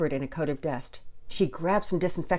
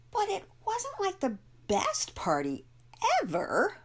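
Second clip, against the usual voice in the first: put-on high-pitched girl with a slight edge, drawn out; grudging, high-voiced and slow on the stressed words; slower than usual. A narrator's reading. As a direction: let a little edge creep into a child's voice. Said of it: "a slight edge creeping into her voice".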